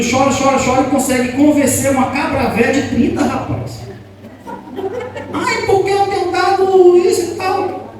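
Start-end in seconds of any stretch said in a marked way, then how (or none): 5.3-7.9 high, whiny mock parent; preacher mocks parents who give in to crying. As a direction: mimic a pampering parent with scorn. Mocking an indulgent parent.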